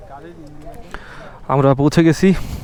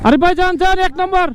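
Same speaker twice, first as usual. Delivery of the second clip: shouting directions; 12 semitones higher, 6 dB louder than usual. Real, not acted. Guiding rider up steep climb.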